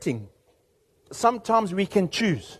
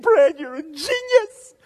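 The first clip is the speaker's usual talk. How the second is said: delighted mother; 9 semitones higher, slower than usual. Retold or imitated. Grateful mother returns.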